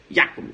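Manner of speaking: "Jacobs" punched as YA-cobs; correct pronunciation. Shown as a demonstration. Teacher repeats the name for students, German pronunciation. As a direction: hit the first "iá" of "Jacobs". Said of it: "Você fala".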